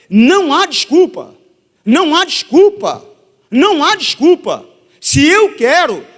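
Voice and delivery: high, loud, emphatic declarations; impassioned preaching. Real, not acted. Preacher at the climax of exhortation. (pregação inflamada, em voz alta)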